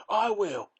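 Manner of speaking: high, loud eager cry; excited shout. A narrator's reading. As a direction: shout it out, volunteering. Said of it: "shouted Grandpa Joe".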